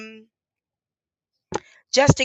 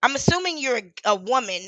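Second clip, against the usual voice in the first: normal talking voice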